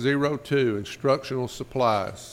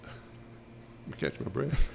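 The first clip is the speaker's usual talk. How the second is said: whispered aside; 8 dB quieter, slower than usual. Real, not acted. Aside while breathless.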